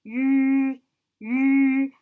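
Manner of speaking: "You" with lips pursed as if whistling; sustained whistle-lipped "you" vowel; rounded vowel demo. Shown as a demonstration. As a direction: hold a loud, level "you" through whistling lips. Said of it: "sounds like you're saying you and you're trying to whistle".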